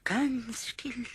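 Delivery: hushed whisper, slow. Acted. Kobold whispering to himself not to move. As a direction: breathe the words to keep still. Pumuckl hiding, holding still.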